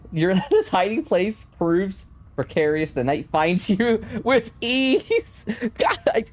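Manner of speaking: high-pitched, breaking into laughter; laughing while reading. Real, not acted. Player reading that the knight finds the hiding place, laughing. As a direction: read it out through real laughter.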